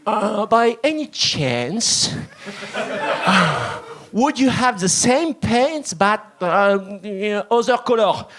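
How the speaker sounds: put-on slow, careful French-accented English; his best English accent. Frenchman asking a New York salesman, halting French-accented English. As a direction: proudly sound out each English word, French accent. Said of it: "avec mon meilleur accent".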